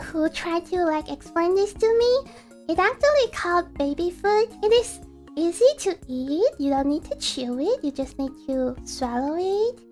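ordinary talk

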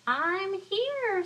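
high, loud sly fox; a fox announcing himself. Acted. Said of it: "said the fox".

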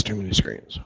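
grumbling aside to yourself, "Too many screens" trailing off quiet; muttered repeat. Real, not acted.

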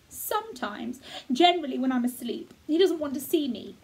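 put-on a boy speaking of his absent father; lightly voiced boy. Colin voiced by reader.

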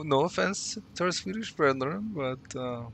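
ordinary talk

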